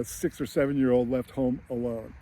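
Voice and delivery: normal talking voice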